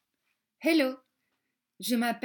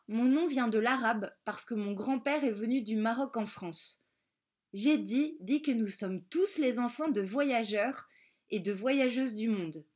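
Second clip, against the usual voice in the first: ordinary delivery, nothing marked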